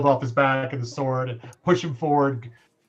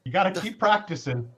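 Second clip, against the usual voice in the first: teasing; 6 semitones higher, 4 dB louder than usual. Acted. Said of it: "just rib him some".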